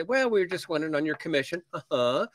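nervous sellers squeaking out a request; voice up an octave. Retold or imitated. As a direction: climb up high as you dare to ask. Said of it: "their voice goes up an octave".

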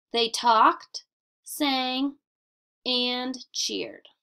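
short clear pauses after talked and sang; pausing at commas. Shown as a demonstration. Teacher reading example to students, pausing at commas. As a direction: let each comma breathe before the next verb. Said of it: "A comma tells the reader when to pause".

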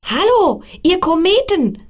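put-on high, loud child call across the sky; calling out loudly. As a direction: shout to the far-off comets. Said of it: "rief er ganz laut".

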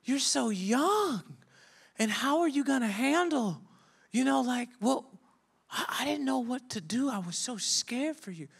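put-on high-pitched, worried old lady. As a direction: fuss over him like a frightened grandmother. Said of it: "she was like".

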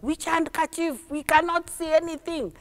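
lightly acted high answering voice; passengers answering back. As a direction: call back as puzzled passengers. Passengers answer the calling boy.

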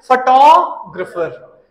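फोटोग्राफर slowly as "fuh-TAW-gruh-fer"; correct photographer pronunciation. Shown as a demonstration. Teacher urging practice, फोटोग्राफर as "fuh-TAW-gruh-fer". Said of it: "बोल बोल के".

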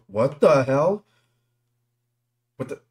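loud, rising disbelief; jolted, incredulous shout; real startled outcry. Real, not acted. Startled by something in game.

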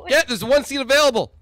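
high loud urgent call; shouting. Real, not acted. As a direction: yell out the last seat. Seat rush in game.

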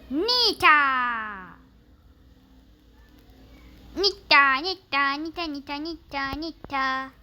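loud repeated call of Nita. Real, not acted. Gamer calling out the character name Nita, loud. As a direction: call out Nita loudly, dropping at the end.

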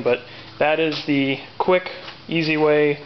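normal talking voice

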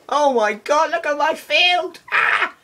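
very high, loud squeal; high-pitched outburst ending in a yelp; excited screaming. Real, not acted. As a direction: shriek it in amazed excitement. Excited outburst during game fight.

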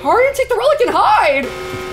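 high-pitched panicked cry. Real, not acted. Player reading an urgent instruction in a scare, panicked. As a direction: blurt it high in alarm.

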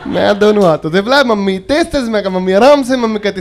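ordinary talk, nothing marked

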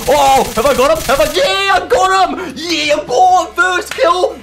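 high, loud victory whoops; excited yelling. Real, not acted. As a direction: celebrate the first kill at the top of your voice. First kill celebration.

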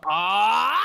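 loud, high cry of shock; anguished scream. Real, not acted. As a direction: scream at the bad news. Learns his dog was killed.

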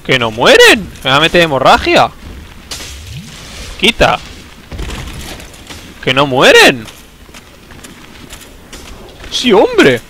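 yelling at the screen in frustration; frustrated shouting. Real, not acted. Enemigos que no mueren, desesperado. (gritando frustrado)

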